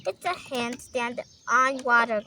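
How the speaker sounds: ordinary talk, nothing marked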